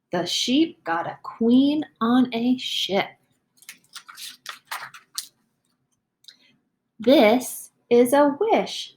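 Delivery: slow, expressive storybook reading; slow picture-book read-aloud; reading to children. Acted. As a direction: read it slowly for young listeners. Teacher reads story to children.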